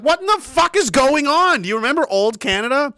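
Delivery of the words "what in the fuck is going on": much higher, louder disbelieving yelp; baffled outburst. Retold or imitated. Caller reacting to a strange help-line greeting, loud baffled yelp. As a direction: blurt it out in total disbelief. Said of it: "And you're like".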